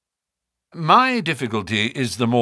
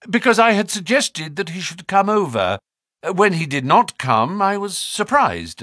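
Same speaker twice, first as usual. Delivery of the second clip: higher-pitched, earnest character voice; uneasy naturalist; 8 semitones higher than usual. A narrator's reading. Naturalist explaining why he came out, uneasy. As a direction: explain yourself with nervous emphasis. Stapleton's voice in the story.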